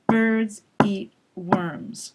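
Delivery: "Birds eat worms" slow, each word beat out evenly; slow rhythmic stress drill; stress-timed rhythm. Shown as a demonstration. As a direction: tap out the three stressed beats. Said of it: "said really quickly like this".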